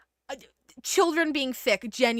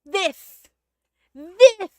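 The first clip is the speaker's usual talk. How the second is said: higher, louder emphatic agreement; 7 semitones higher, 9 dB louder than usual. Real, not acted. Streamer agreeing with the game line, loudly emphatic. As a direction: stamp total agreement on it.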